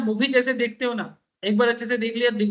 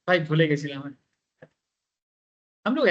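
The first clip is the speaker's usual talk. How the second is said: lowered, Bengali-flavoured "भाई भूले गए छिलाम" with Bengali vowels; Bengali accent in Hindi; 6 semitones lower than usual. Shown as a demonstration. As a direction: speak it like a Bengali friend apologising. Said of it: "हम लोग जैसे Bengali style में".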